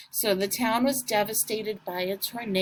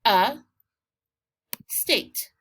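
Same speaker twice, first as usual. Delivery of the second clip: dev, a, state with long pauses between; three isolated syllables: dev, a, state; 3 semitones lower, much slower than usual. Shown as a demonstration. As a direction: dictate each piece of devastate alone. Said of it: "let's do our syllables".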